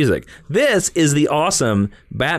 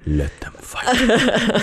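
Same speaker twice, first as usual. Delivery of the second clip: very slow, stretched quote delivery; drawn-out movie quote; 10 semitones higher, much slower than usual. Pretend. Host hamming up the famous movie line slowly. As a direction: draw the line out dramatically.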